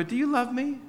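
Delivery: high, pleading little voice; mock needy plea. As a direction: ask it like a fishing husband. Husband asking wife playfully.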